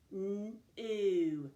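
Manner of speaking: a hummed N, then a held OO; sounded out. Shown as a demonstration. Teacher showing two sounds in a syllable, sounded out. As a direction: pull NEW apart into N and OO. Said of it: "Two sounds".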